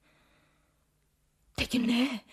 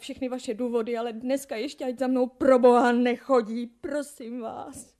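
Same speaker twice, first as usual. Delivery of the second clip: louder, pleading with her father in distress; anguished loud begging; loud desperate plea; 3 semitones higher, 10 dB louder than usual. Acted. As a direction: beg him, voice rising in despair. Daughter pleads against marriage.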